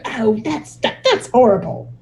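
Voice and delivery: imitating a scandalized English lady, put on; lightly hammed-up English accent; weird English accent. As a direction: do a prim British "that's horrible" in a mock English accent. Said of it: "You're doing a weird English accent".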